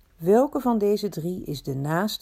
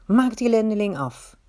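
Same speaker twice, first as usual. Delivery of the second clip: louder, higher urging; vicious command; 4 semitones higher, 6 dB louder than usual. A narrator's reading. Robber urges the others on against the traveller, vicious. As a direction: order the attack with raised force.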